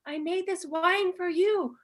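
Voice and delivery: high, drawn-out sweet address to grandma; eager winemaker's voice. Acted. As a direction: ask Nona in a put-on high voice. Voicing someone asking old Nona.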